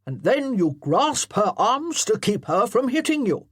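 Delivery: loud parade-ground colonel; commanding colonel. Acted. Colonel directing his amateur actors, loudly commanding. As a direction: bark orders like a drill officer.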